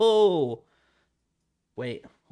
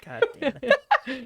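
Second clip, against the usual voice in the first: laughing frustration; 10 semitones higher, 6 dB louder, slower than usual. Real, not acted. Frustrated at missed answer.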